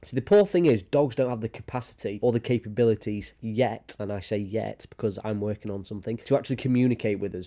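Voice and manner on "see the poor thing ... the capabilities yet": ordinary talk, nothing marked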